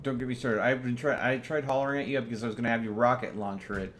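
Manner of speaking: ordinary talk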